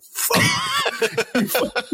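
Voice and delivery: high, drawn-out laughing curse; long high laughing expletive; laughing dismay. Retold or imitated. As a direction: groan it through a laugh.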